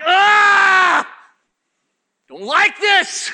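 high, loud wail, then slow complaint; mock scream of protest. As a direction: scream like the flesh recoiling. Acts his old flesh protesting.

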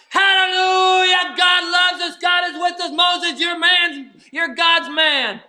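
mock crowd rejoicing after the sea crossing; rejoicing shout. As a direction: shout hallelujah like a celebrating crowd.

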